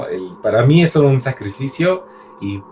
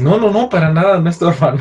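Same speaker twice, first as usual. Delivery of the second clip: startled denial; 5 semitones higher, 4 dB louder than usual. Retold or imitated. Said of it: "se espanta y dice".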